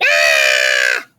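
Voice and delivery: put-on open-mouthed kid's scream, loud and long; big scream. Boy screaming at the girl to get the book. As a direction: let out a huge wide-mouthed scream.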